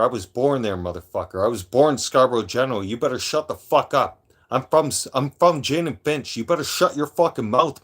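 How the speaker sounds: heated, forceful tirade on a roll; angry threats. Real, not acted. Livestreamer warning a mouthy chatter to shut up, angry threats. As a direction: lay down the law, leaning on the curses.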